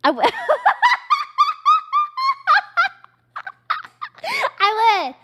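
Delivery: squeaky embarrassed giggle; high giggle. Real, not acted. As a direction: burst into a high giddy laugh. Laughing before the confession.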